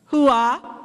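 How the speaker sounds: punchy army battle cry; loud gung-ho soldier. Real, not acted. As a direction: shout it like a drill sergeant. Military cheer ending a speech.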